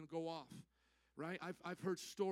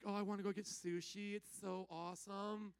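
put-on sushi-loving girls; 3 dB quieter than usual. Girls now wanting sushi, mock gushing. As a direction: imitate girls keen on sushi, trailing softer.

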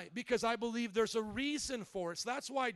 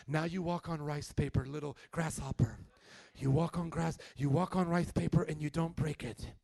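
low, put-on sage master voice; mock martial-arts master; 5 semitones lower, 4 dB louder than usual. Master instructing little grasshopper on rice paper, mock sage voice. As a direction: instruct the pupil as a parody wise master.

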